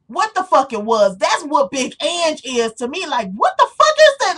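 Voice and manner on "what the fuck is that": much higher, louder baffled question; loud disbelief. Real, not acted. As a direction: shout it in utter confusion. Ranting at Big Ange.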